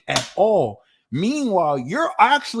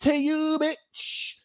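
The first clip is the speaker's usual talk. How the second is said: much higher, trailing "To you, bitch" softly; softer sassy comeback; 12 semitones higher, much slower than usual. Shown as a demonstration. Repeating the comeback for viewers, higher and fading. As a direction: drop it dismissively. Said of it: "turn it back to them and be like".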